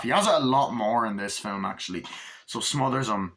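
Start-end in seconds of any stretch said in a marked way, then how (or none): none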